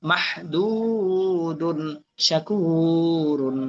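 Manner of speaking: very slow, stretched long vowel in each word; elongated vowels. Shown as a demonstration. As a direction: hold the madd, one word at a time. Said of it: "শুধু টান আসলে টান দিব". (দীর্ঘ টান দিয়ে ধীরে উচ্চারণ)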